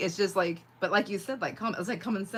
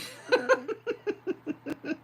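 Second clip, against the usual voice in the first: bursting into a high laugh; a high laugh that trails off; real high laugh; 10 semitones higher than usual. Real, not acted. Laughing at how she misread the chat.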